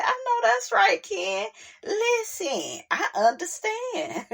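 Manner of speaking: high, tearful mock sobbing into a laugh; mock emotional cry. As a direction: gush like you're moved to tears, half joking. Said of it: "I said".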